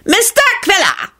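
high-pitched pompous doctor; shrill, pompous. A narrator's reading. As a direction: trumpet the name shrilly, full of self-importance. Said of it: "shrilled a pompous voice".

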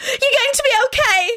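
put-on very high girlish shriek. A narrator's reading. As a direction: shriek it at the top of your range. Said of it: "Rosie shrieked".

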